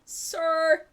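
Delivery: ordinary talk, nothing marked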